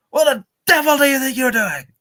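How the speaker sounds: high, loud mock-posh English outburst; hammed-up posh English scolding; posh outraged old man. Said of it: "was he a posho?".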